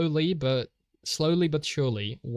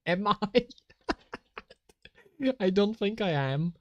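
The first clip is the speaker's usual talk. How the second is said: laughing; 6 semitones higher than usual. Real, not acted. Laughing at being accused.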